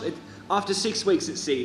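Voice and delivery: usual speaking voice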